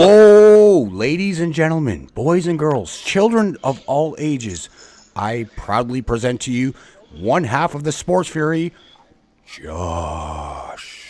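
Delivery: booming wrestling ring announcer. Acted. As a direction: announce the guest like a big fight. Ring announcer introducing a guest.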